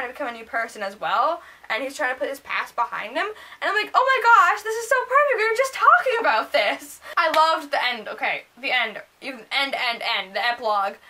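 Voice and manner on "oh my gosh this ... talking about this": high, loud fangirl exclamation; reenacted giddy realization; amazed excitement. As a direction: blurt it out in thrilled disbelief. Acting out amazed reaction while reading.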